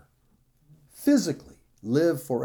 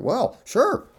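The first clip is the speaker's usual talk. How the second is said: breezy woman's reply; 10 semitones higher than usual. Acted. Voicing the woman answering the serpent.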